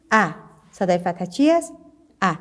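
ordinary talk

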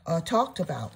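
ordinary talk, nothing marked

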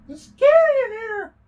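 loud, drawn-out, high crying squeal; mock fright. Player in a scary dark room, mock-frightened wail. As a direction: squeal it as if terrified, hammed up.

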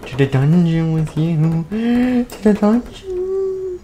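slow, high, stretched-out menace; gleefully sinister chant; creepy villain sing-song. Acted. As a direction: draw it out, climbing into eerie falsetto. Bonnet sends victim to dungeon.

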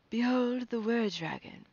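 high, declamatory call; proclaiming cry. A narrator's reading. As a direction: announce it to the whole horde. Chieftain proclaims to his hunters.

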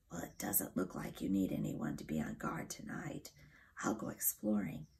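a small dry animal voice, breathed; dormouse whisper. A narrator's reading. Dormouse tells the children he'll explore, whispering.

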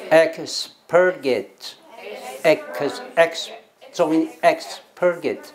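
slow, emphatic "expurgate" with EX hit hard; stress on EX. Shown as a demonstration. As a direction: drill the first syllable of "expurgate", stretched out. (放慢念 expurgate，重音强调 ex)

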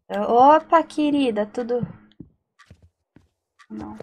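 ordinary delivery

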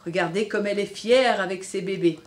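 usual speaking voice

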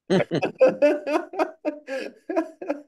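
long, high burst of laughter; real laughter. Real, not acted. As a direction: laugh out loud at your own joke. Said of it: "all joking aside".

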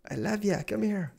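high, eager pet-calling voice; gleeful high call to a buddy; affectionate calling. Pretend. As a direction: coax a beloved critter over. Calling out to a game animal.

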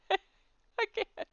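laughing. Real, not acted. Laughing at own fumbling.

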